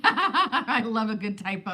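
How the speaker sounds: laughing through the words; chuckling while speaking. Real, not acted. Laughing over a typo.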